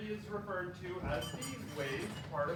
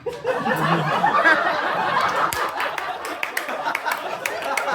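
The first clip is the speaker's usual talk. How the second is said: loud airy helpless laughter; long wheezing laughter; breathy laughing fit; 9 semitones higher, 15 dB louder than usual. Real, not acted. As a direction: totally lose it.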